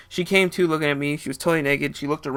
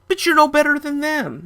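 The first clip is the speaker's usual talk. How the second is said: high, loud accusation; accusing; 13 semitones higher, 7 dB louder than usual. Retold or imitated. Narrator accusing the woman, high and indignant. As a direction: call her out, indignant.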